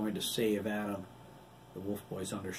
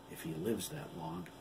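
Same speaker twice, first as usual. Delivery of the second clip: grim quiet remark; 4 semitones lower, 7 dB quieter than usual. A narrator's reading. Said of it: "Kelly said".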